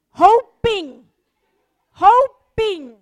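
preacher's pleading stress; emphatic. Acted. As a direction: lean hard into each hoping.

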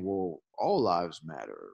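mock dismissive, dropped low. Guest quoting people who dismiss the movement, dismissive. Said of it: "Don't be dismissive of saying".

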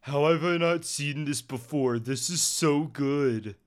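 put-on voice of a chat commenter; slightly put-on chat-reader voice; Twitch chat voice. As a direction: read it out as an excited chat viewer, lightly voiced. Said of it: "Is that your Twitch chat voice?".

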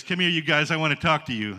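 loud, commanding summons; calling out. Retold or imitated. Mayor calling the pastors over, loud summons. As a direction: call them over firmly.

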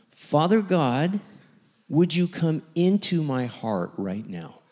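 slow, drawn-out prayer opening; slow earnest prayer. Retold or imitated. Pastor leading the man in prayer, earnest. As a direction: lead him in sincere prayer. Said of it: "I just go for it".

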